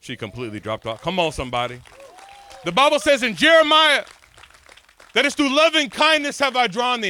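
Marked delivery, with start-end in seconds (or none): 2.6-7.1 high, loud preaching; full-force sermon cry; preaching shout. Acted. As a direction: shout it to the congregation.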